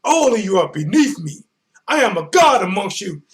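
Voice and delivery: loud, high imperious god voice; megalomaniac rant. Retold or imitated. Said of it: "Joshua's stopping like, like Loki".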